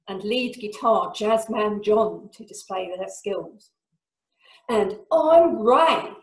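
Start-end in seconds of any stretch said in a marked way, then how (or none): 4.7-6.1 loud, high, slow compere shout. Acted. Compere introduces himself to a cheering crowd, shouted. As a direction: shout your own name over the cheers. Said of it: "he shouts to more cheers".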